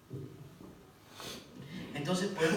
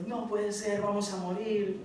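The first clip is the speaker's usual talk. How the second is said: mock panicked cry; 6 semitones higher, slower than usual. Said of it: "Los discípulos estaban gritando".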